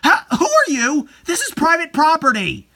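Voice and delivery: high, loud startled cry at a stranger; startled shout. Acted. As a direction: shout in alarm at the intruder. Nana confronts an intruder.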